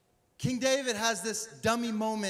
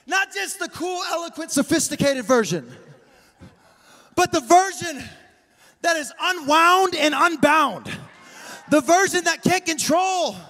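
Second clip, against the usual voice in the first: raised, high-energy sermon delivery; full-force preaching; 9 semitones higher, 6 dB louder than usual. Acted. Preacher urging a stoic congregation to dance, full-force and fervent. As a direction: drive every phrase home to the congregation.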